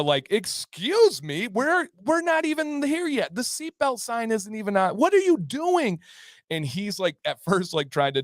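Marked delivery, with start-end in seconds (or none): 0.3-6.0 high-pitched outraged objection; mock indignant passengers. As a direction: imitate passengers telling someone off. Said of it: "people are getting loud".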